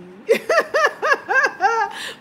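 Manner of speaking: high, loud burst of laughter; real helpless laugh. Real, not acted. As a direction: crack up at your own joke. Laughing at own impression.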